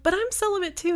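high-pitched mimic of a friend; high mocking quote. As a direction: squeak out the friend's reply. Friends mimicked in a retold chat.

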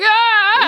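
high, loud mock scream; imitated scream. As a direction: yelp as if seeing your own wound. Imitating his scream on looking.

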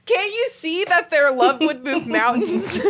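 hammed-up lovestruck plea; mock pleading. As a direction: beg him to get it, laughing. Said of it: "I get mad at Sean".

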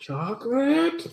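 high, slow mock squeak; squeaky high voice. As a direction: squeak the question out slowly. Mocking the chocolate joke.

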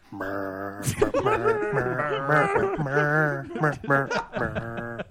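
high loud mer mer squeal; loud jingle hum. Pretend. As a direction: belt out the goofy jingle. Jingle noise, louder.

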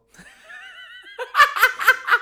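high shrieking burst of laughter; loud shrieking laugh. Real, not acted. Huge laugh at heckling story.

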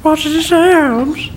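mocking parrot. Mockingly parrots friend's question.

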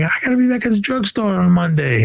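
a part-timer fretting about his day job; whiny weekend soldier. Retold or imitated. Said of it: "And he's like".

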